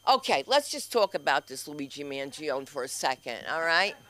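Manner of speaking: ordinary talk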